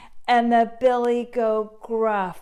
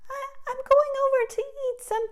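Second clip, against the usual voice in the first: very high, stammering little voice; tiny scared goat; 5 dB louder than usual. Acted. Littlest goat answering the troll, very high and timid. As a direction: squeak it out nervously.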